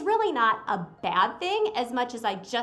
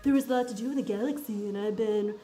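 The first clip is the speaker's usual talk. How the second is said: casual one-liner; 2 semitones lower than usual. Retold or imitated. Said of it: "one-liner that's like".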